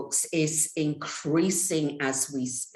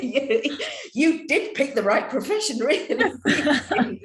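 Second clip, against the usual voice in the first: laughing through the words, higher pitched; higher, laughter-filled teasing; amused laughter; 6 semitones higher than usual. Real, not acted. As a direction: tease the guest through a laugh. Joking with guest, laughing.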